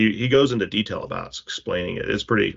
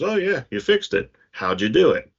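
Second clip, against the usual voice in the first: higher, mock-surprised father; impressed dad; 6 semitones higher than usual. Father praising his son's repair, a lightly higher mock surprise. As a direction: sound pleasantly amazed at the fix. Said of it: "he grabs the toy from the boy and goes".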